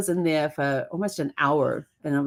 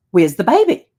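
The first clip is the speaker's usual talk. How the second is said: louder child's question, lightly voiced; a hint of a curious niece; niece asking; 2 semitones higher, 9 dB louder than usual. Niece voiced in retold story.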